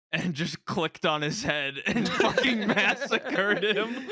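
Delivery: cackling through the story; laughing. Real, not acted. Streamer laughing to friends about killing a friendly player, laughing. Laughing while retelling the massacre.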